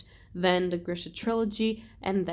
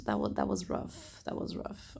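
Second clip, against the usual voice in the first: whispered, lower and much softer; hushed sympathy; 11 semitones lower, 8 dB quieter than usual. Retold or imitated. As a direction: breathe it out as a quiet condolence. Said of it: "I was like".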